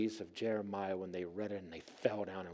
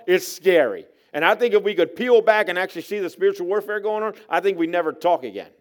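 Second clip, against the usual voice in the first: loud, high, urgent preaching; raised-voice pulpit testimony; preaching at full force; 9 semitones higher, 15 dB louder than usual. Real, not acted. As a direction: drive the warning home to the congregation.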